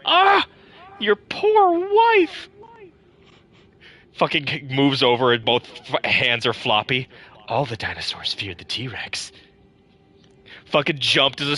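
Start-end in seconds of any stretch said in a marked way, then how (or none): 0.0-2.5 very high, drawn-out wail; mock agonized cry. Fan acts out hero whose other hand breaks, mock agonized wail. 7.5-9.3 low, softer quoted line; quoted movie hero. Acted. Fan quotes the hero with floppy hands, low voice.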